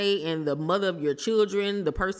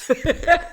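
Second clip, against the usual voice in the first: high burst of laughter; giggling fit; loud laugh; 11 semitones higher, 7 dB louder than usual. Real, not acted. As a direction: crack up at your own joke. Laughs at her fart joke.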